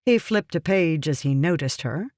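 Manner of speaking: ordinary delivery, nothing marked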